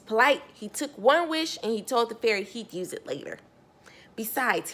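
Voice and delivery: ordinary delivery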